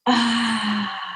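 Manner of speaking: long open "ah" on the out-breath; voiced exhale sigh. Shown as a demonstration. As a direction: release the breath with a sound. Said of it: "Make a little sound with the exhale".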